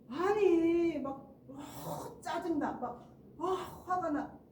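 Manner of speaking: loud, high burst of irritation with drawn-out huffs; acted annoyance. Pastor showing listeners at a meal someone getting angry, irritated. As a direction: pretend to blow up, exasperated. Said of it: "내가 화가 났어요".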